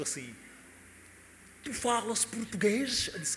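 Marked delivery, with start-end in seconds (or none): 1.6-3.1 put-on slow old man's European Portuguese accent; lightly voiced old Portuguese grandpa asking; Lisbon-accented question. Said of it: "sotaque lá de Portugal".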